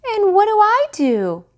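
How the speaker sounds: put-on high, loud little-boy question; small boy asking. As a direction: ask eagerly like the youngest child. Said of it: "asked Petey".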